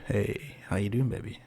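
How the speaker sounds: soft, low come-on to the musicians; seductive murmur. Shown as a demonstration. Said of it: "I look at them and go".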